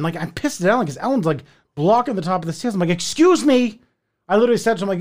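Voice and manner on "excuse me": high retold "excuse me"; mildly exasperated demand to get past. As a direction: ask to get by, losing patience. Trying to pass blocking neighbour.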